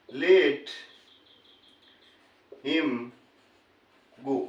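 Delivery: let, him, go, each word alone with long pauses; word-by-word dictation. Shown as a demonstration. Teacher giving students the translation, slow and word by word.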